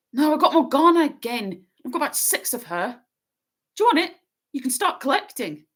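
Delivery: a hint of a casual schoolboy; the narrator voicing Ron offhand; Ron's voice. A narrator's reading.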